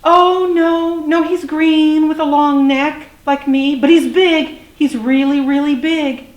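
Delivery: high, loud, earnest youngster; little dinosaur. A narrator's reading. Little dinosaur describes Daddy to flyer, high earnest voice. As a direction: insist in an eager child character voice.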